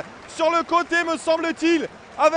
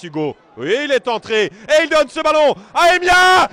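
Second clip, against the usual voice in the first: normal talking voice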